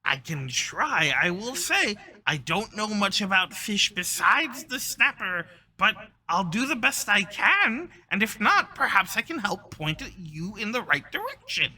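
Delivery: high, loud, eager shopkeeper creature; helpful kobold. Acted. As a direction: offer help in a high kobold voice.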